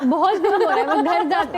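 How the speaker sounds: playful laughing gripe; laughing complaint. Real, not acted. Mock complaint about long workdays.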